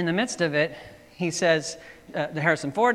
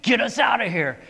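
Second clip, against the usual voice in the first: put-on raised, louder urgent call to the kid driver; Indiana Jones calling out; 8 semitones higher, 6 dB louder than usual. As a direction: shout it as the hero in trouble. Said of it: "and he's all".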